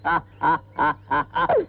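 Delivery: low hammed-up laugh on request; put-on audition laugh; forced centre laugh. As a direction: fake a big laugh for the camera. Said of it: "சென்டரா சிரிங்க".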